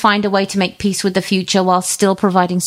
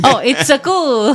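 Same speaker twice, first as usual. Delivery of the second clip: slow, laughing mock Italian accent; hammy Italian accent through a laugh; 5 semitones higher, slower than usual. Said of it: "weak attempt at an Italian accent".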